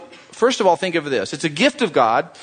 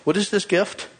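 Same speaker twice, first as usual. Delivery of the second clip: ordinary talk, nothing marked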